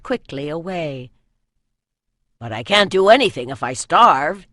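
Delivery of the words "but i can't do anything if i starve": a louder, cheerful quip to lift the mood; brightly joking boy. A narrator's reading. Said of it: "he added brightly".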